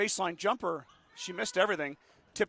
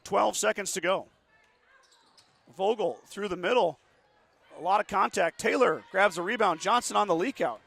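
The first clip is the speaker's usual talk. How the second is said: rising, louder live game call; excited play-by-play; 3 semitones higher, 6 dB louder than usual. Real, not acted. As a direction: call the last seconds with building urgency. Live call of final seconds.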